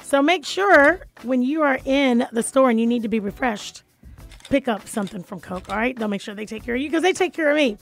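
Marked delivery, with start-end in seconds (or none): none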